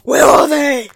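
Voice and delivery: mock Batman. Joins the Batman bit.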